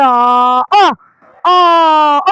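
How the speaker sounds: loud startled screams. Real, not acted. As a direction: yell as the floor gives way. Falling into trap, screams.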